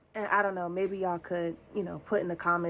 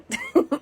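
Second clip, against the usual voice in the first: very high, loud laugh; short real burst of high laughter; 7 dB louder than usual. Real, not acted. Confessor laughing off being judged, high.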